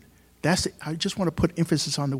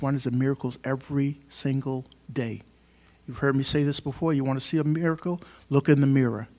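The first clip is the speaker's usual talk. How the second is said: ordinary delivery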